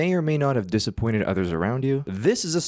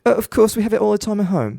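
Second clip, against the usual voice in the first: put-on high, loud, cocky British accent; cocky fake British accent, bragging over fish and chips; 10 semitones higher, 5 dB louder, faster than usual. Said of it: "I'm getting cocky and saying".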